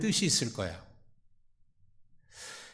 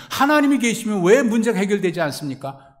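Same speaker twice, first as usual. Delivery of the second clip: pleading cry; 9 semitones higher, 8 dB louder than usual. Acted. Gideon's complaint to God acted out.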